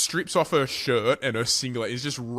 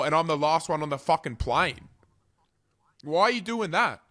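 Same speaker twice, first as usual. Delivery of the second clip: ordinary talk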